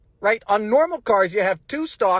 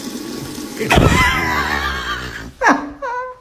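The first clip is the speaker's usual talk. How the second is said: laughing scream. Real, not acted. Laughing while shifting gears.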